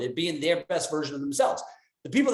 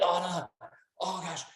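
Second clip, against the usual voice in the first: fading to a defeated, much softer groan; negative voice; 2 semitones higher, 6 dB quieter, slower than usual. Pretend. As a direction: sigh it out like all is lost. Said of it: "In your negative voice".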